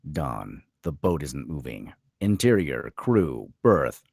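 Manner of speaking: ordinary talk, nothing marked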